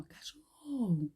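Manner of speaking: soft, slow, drawn-out word; just pronouncing it quietly. Shown as a demonstration. Teacher showing pupils they needn't shout, pronouncing quietly. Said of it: "Só pronunciando".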